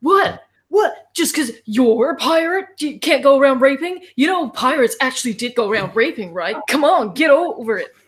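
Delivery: mock defensive director. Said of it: "he goes".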